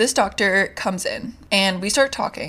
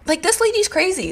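incredulous; 10 semitones higher than usual. Real, not acted. Disbelief at the doctor's call.

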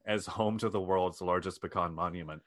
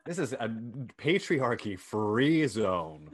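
slow proclamation; slower than usual. Acted.

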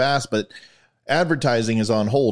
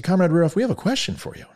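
high-pitched, rising appeal; calling out; 9 semitones higher, faster than usual. Acted. Married couple putting a question to Comrade Rudolph, calling out. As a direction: call up to an official for help. Couple call to officer in joke.

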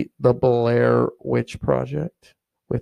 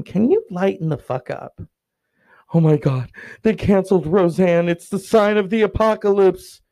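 higher, louder panicked doomsday friend; mock doomsday panic; 5 semitones higher, 5 dB louder than usual. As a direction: play the hysterical doomsday friend. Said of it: "you wanna say to them".

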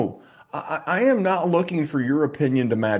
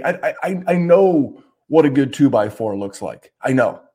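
ordinary delivery

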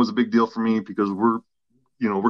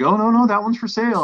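much higher, louder chirpy saleswoman voice; imitating the saleswoman; 12 semitones higher, 4 dB louder than usual. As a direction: play the bubbly clerk correcting him. Voicing the saleswoman Vanessa.

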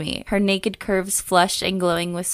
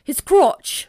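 higher, louder mock echo of the phrase; 9 semitones higher, 5 dB louder than usual. Co-host teasing the reader over "crotch", mocking echo. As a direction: parrot it loudly in a put-on voice.

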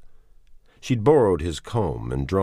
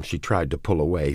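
plain reading voice

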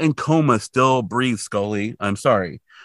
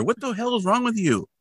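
high, exasperated question; mock indignant; 11 semitones higher than usual. Real, not acted. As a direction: scold him, exasperated and high. Scolding Mulder mock-indignant.

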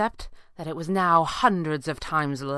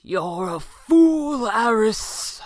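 loud, drawn-out, higher-pitched warning; stern warning, words stretched out; grave warning; 5 semitones higher, 4 dB louder, much slower than usual. A narrator's reading. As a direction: warn him in a slow, raised character voice. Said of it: "Falcone warned".

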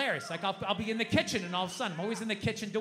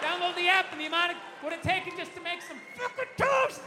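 high, loud exasperated outburst; flipping out; 7 semitones higher, 3 dB louder than usual. Acted. Dad cursing over making toast, flipping out. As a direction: let the fury burst back out. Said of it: "whenever I start flipping out".

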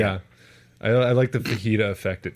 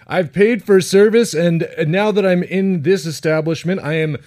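slow, deliberate, higher-pitched entitled customer; mock-official indignation, slowed and pitched up; mock entitlement; 4 semitones higher, 4 dB louder than usual. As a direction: pronounce it like a self-important legal declaration. Parody of weird entitled customers.

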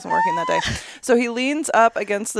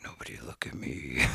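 whispering; 13 dB quieter than usual. Retold or imitated. Shy wish in public.

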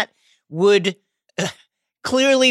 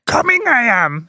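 higher-pitched mock alien sage voice; Yoda; 4 semitones higher than usual. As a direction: do a bawdy joke impersonation of the little Jedi master. Joking as Yoda, inverted syntax.

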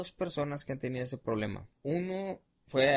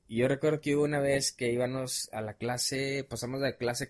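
usual speaking voice

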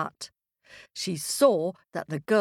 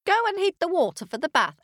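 quick, high-pitched order to a servant; bossy witch giving orders; 12 semitones higher, 3 dB louder, faster than usual. Acted. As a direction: order the maid about briskly.

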